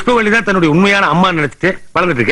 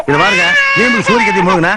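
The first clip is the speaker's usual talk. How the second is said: ordinary talk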